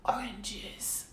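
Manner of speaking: whispered aside; hushed whisper. Acted. Mock-dramatic aside about hated oranges.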